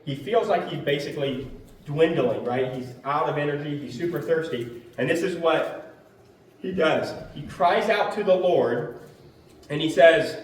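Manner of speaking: ordinary delivery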